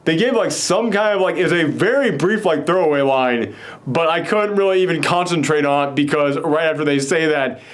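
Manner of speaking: ordinary talk, nothing marked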